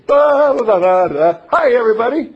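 high and loud singsong hello; bouncy sung-out greeting; cheery greeting. Retold or imitated. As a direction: breeze in humming brightly. Cheerful entrance into arguing group.

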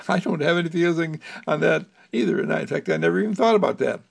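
put-on high, lively little boy piping up; a small boy eagerly agreeing, high and quick; Jerome's voice. Puppet boy Jerome answering Mister Candle, in a high childish voice.

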